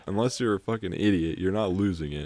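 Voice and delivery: usual speaking voice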